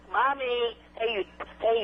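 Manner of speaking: ordinary talk, nothing marked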